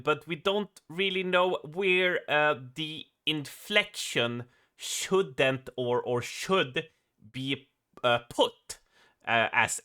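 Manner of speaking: halting, drawn-out Swedish accent; typical Swedish accent. Shown as a demonstration. Swede demonstrating the awkward delivery, typical Swedish accent. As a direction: feel for each word with Swedish inflection. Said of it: "like, the typical Swedish accent".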